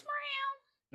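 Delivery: high, thin meow; a high little cat call; mock cat meow. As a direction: imitate a cat, leprechaun faking it. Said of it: "throws his voice again and goes".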